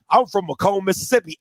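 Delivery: low, dopey buddy voice; mock homeboy voice. Imitating the coach as a low, dopey buddy. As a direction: play the fake friend, low and dopey. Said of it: "And you're like".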